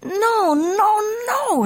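very high-pitched little-dog protest; protesting pups. Acted. Puchitos correcting Skipito, protesting. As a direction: yip out the denial high.

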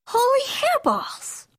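very high, slow, stretched exclamation; exclaiming. A narrator's reading. Kitty boy at the mirror, exclaiming. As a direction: cry it out high in amazement. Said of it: "exclaimed Skippy John Jones".

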